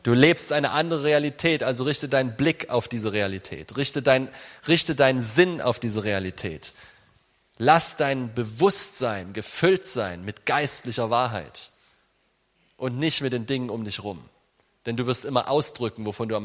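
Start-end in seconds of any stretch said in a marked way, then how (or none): none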